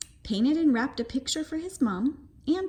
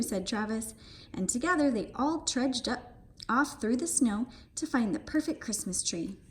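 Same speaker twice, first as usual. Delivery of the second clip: plain reading voice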